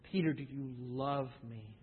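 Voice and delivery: the last, gentlest asking; tender question. Acted. Jesus to Peter the third time, low and soft.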